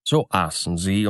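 usual speaking voice

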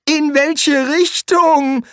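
high, slow, loud quavering question; trembling voice. Acted. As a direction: ask in a frightened, shaky voice. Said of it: "fragte der Quästor mit zittriger Stimme".

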